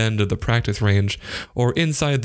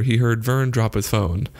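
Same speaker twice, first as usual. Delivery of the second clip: plain reading voice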